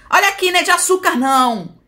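loud, high, rapid-fire scolding; angry retold shout. Mother snapping while shaking the newborn, angry high shout. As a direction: imitate a rough, raging mother at full voice. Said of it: "parecia que tava com raiva".

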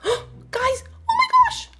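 gasp, then very high, loud disbelief; excited discovery. Real, not acted. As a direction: squeal it to the viewers in shock. Discovers she is on the map.

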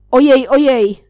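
loud, high ojej, ojej; startled cry. Acted. Mr Schulz hit by the rake handle, a loud, startled cry. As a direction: yelp as the rake hits your face. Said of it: "zawołał pan Schulz".

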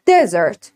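Desert with stress on the first syllable, falling. Shown as a demonstration. Teacher models Desert for the class, stress first. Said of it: "روی قسمت اول باشه".

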